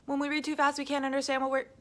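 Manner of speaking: rushed, loud and high; reading too fast. Shown as a demonstration. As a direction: race through the words. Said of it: "But as you can see".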